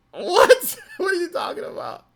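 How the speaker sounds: high, squealing laugh through the words; real laughing disbelief. Real, not acted. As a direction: crack up in surprise. Reacting to chat comment.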